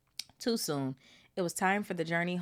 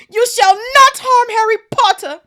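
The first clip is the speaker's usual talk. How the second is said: high, loud elf shout; Dobby shouting; 20 dB louder than usual. Acted. Dobby shouting at Malfoy to defend Harry, high elf shout. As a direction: shriek it to protect Harry. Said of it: "But Dobby shouted".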